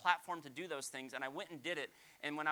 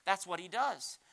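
ordinary delivery

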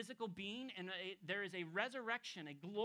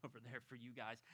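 normal talking voice

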